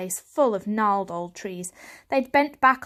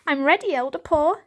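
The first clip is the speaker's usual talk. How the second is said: higher, louder eager kitten; 7 semitones higher, 5 dB louder than usual. A narrator's reading. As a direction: answer with keen readiness. Eager young Varjak.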